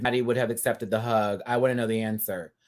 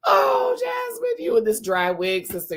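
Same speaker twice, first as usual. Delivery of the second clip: high excited call. Real, not acted. Calling out Jasmine about her dry wig in a high excited squeal.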